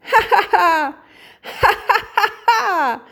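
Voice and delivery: high, loud story-book laughter; acted laughing out. A narrator's reading. Little hare laughing at a singing rainbow, acted high laughter. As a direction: burst into a hearty acted "ha ha ha". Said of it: "lachte Pieps lautlos".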